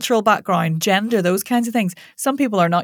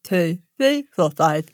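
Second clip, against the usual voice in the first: days said with tongue stuck out. Shown as a demonstration. Coach demonstrating tongue-out warm-up, words blurred by tongue. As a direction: speak around an outstretched tongue. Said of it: "you're gonna stick your tongue out".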